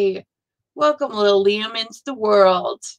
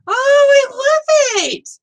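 much higher and louder, gushing; delighted squeal; 5 dB louder, slower than usual. Real, not acted. Crafter falls for a kitchen-themed pattern, squealing with delight. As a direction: coo it out, overjoyed.

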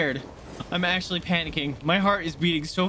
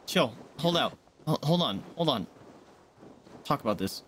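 normal talking voice

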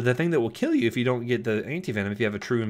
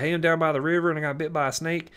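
put-on rural Florida accent; 7 semitones higher than usual. Caller phoning about a snake bite, rural Florida accent. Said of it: "talk like that all the time in my natural accent".

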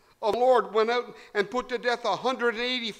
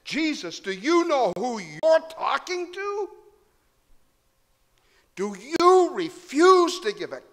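building from level to loud, higher, slow offended demand; indignant Pilate; 6 semitones higher, 6 dB louder, slower than usual. Acted. Pilate demanding that silent Jesus explain himself, growing offended. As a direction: rise to an outraged governor. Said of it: "Pilate's incredulity".

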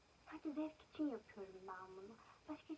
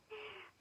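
short high laugh; 10 semitones higher than usual. Real, not acted. Laughs while upset.

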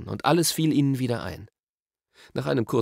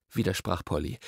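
ordinary delivery, nothing marked

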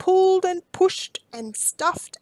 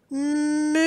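short cow moo; 2 semitones higher than usual. Acted. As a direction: answer with a cow's moo. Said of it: "answered Belinda".

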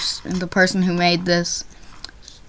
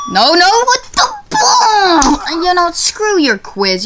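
high cry of frustration; exasperated outburst; 9 semitones higher, slower than usual. Real, not acted. Gamer quitting a badly made quiz, exasperated outburst. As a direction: lash out at the stupid game.

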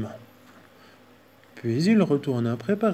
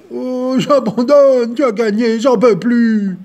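slow, loud, higher wailing; bear crying; 9 semitones higher, 10 dB louder, slower than usual. Acted. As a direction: wail in defeat. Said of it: "s'écroula en larmes".

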